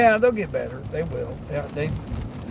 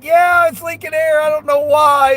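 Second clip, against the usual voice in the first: much higher and louder, playing dumb; mock clueless friend; 10 semitones higher, 7 dB louder than usual. Teasing a friend about the screw in the tire, mock clueless voice, high and loud. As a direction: imitate the friend innocently shrugging it off. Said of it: "you're like".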